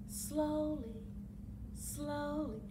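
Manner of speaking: soft slow. Shown as a demonstration. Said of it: "roll them slowly".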